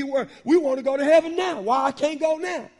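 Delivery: ordinary delivery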